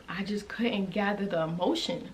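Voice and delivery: whispered through a lump in the throat; choked whisper. Real, not acted. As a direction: barely get it out, throat tight. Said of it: "that ball in the back of your throat".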